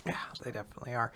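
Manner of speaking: fast, soft whispered aside; quick whisper. Real, not acted.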